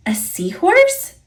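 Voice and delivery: high, rising disbelief; an eager, surprised question. A narrator's reading. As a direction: ask it high and amazed. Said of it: "says Pete".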